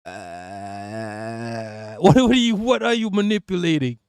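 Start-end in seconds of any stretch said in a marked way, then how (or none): 0.0-2.0 long, low, soft vocal-fry croak; creaky ghost groan. Retold or imitated. Streamer mocking vocal fry as a Japanese movie ghost, creaky groan. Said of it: "a fucking ghost in a Japanese movie".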